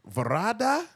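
put-on Indian accent, names drawn out; rolling the name in an Indian accent. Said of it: "That's my Indian accent right there".

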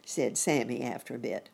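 plain reading voice

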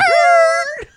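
shrieking burst of laughter; loud high laugh. Real, not acted. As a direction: crack up loudly, high. Big laugh at joke.

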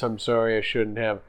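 ordinary delivery

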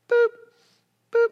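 short imitated machine beeps; monitor beep. Preacher imitating a hospital heart monitor beeping.

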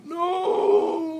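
very high, loud hysterical cry; hysterical scream. Acted. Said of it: "Frank hysterically".

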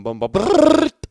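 loud, slow drawn-out booming noises; loud slowed-motion mimicry of the goal; mock slow-motion sounds. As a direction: boom it out like a slowed-down film. Imitating goal moment sounds.

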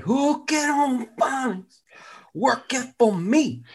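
high, slow, garbled falsetto; failed slogan reader. Pretend. Person who couldn't read the slogan, slow high struggle. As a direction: stretch and mangle the slogan. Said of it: "imagine the person didn't, couldn't do it".

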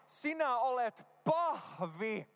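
slow, spaced-out model for the crowd; say-after-me phrase. Shown as a demonstration. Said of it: "Sano näin". (hitaasti ja korostaen toistettavaksi)

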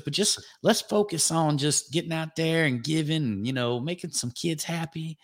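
mock Southern drawl with easy advice. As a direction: do Craig's laid-back Southern drawl, lightly. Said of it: "he says that all in a Southern drawl".

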